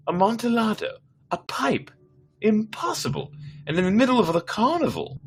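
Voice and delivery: high, excited disbelief; high-pitched astonishment at the news; incredulous drunk Fortunato. Acted. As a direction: raise the voice high, a tipsy nobleman astonished.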